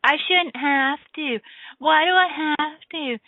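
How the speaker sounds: put-on high, slow, whiny kid complaining; nasal-free pouty child whine, drawn out and high; whining child. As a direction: drag every word out like a sulky child. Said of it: "the complaining, whining child would be like".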